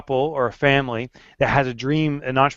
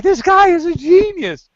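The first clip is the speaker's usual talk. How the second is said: high, loud gushing praise; over-enthusiastic; 6 dB louder than usual. Pretend. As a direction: gush like a superfan. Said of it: "You're like".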